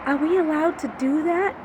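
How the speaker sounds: high, hesitant mock-timid question; timid counselor. Nervous counselor asking permission mid-fight, high timid voice. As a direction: ask it like a nervous coworker. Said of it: "And she goes".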